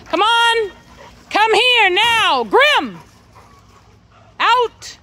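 high, loud call to the dogs. Real, not acted. Owner calling a dog in from the yard, loud high call. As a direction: shout the dogs in from far off.

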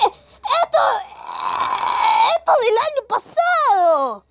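high, strained yelling, half laughing; near-crying exasperated shout; cracking outraged yell. Real, not acted. Outraged at reacting to old memes.

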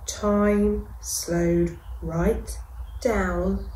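ordinary delivery, nothing marked

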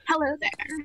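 character impression. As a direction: lightly imitate the camp greeting. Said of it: "it's like".

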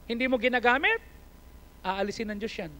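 ordinary talk, nothing marked

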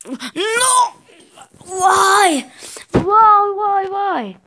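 very high, loud cry of why; despairing shout. Real, not acted. Falls back again.

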